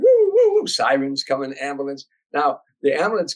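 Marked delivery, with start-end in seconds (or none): 0.0-0.5 very high, louder siren wail; imitated siren. Narrator imitates the ambulance siren arriving, high wail. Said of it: "Now we hear woo".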